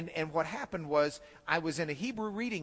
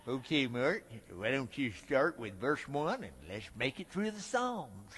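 lower, lips pursed professor; professor's lip voice; 6 semitones lower, 5 dB quieter than usual. Retold or imitated. Recounting class with the Hebrew professor, lip-pursed low imitation. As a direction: mouth every word like the old professor. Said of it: "he kinda talked like this and did his lips".

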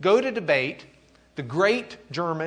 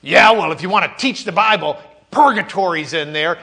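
much louder, higher combative retort; forceful Eck; 5 semitones higher, 11 dB louder than usual. Pretend. Teacher voicing Eck retorting to Luther, loud and forceful. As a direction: fire back at him.

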